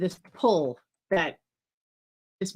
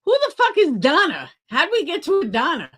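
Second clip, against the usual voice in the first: baffled outburst; 5 semitones higher, 4 dB louder than usual. Real, not acted. Confused reaction.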